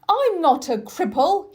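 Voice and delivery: put-on boy's hurt, angry outburst; angry shout. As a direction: fling it back, insulted. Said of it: "he shouted".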